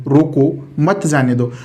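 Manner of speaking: "रोको", a break, then "मत जाने दो" as one phrase; pause after रोको. Shown as a demonstration. Teacher repeats the policeman's reading of the note, pausing after रोको. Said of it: "वह समझता है कि".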